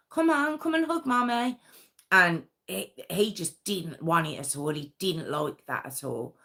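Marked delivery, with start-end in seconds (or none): 0.1-1.6 high sweet coaxing mum voice; imitating a mum calling her boys to hug; mother coaxing. Mum pleading with her sons in the car park, high and coaxing. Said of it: "all she's there going".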